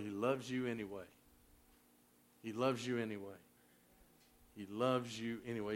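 low, soft, sincere; quiet, heartfelt comfort; tender reassurance. Real, not acted. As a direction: let each repeat settle gently on the listeners. Tender repeated assurance to congregation.

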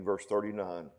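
usual speaking voice

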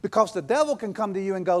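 normal talking voice

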